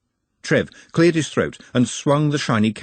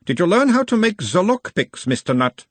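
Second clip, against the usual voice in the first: put-on a mild Uberwaldian accent, the higher voice of a gentle examiner; 10 semitones higher, 3 dB louder than usual. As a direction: question him in a light Uberwald accent, calming the patient. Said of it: "in a mild Uberwaldian accent".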